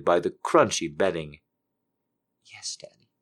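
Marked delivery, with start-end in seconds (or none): 2.5-3.1 whispered sweetly and softly. A narrator's reading. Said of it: "she whispered sweetly".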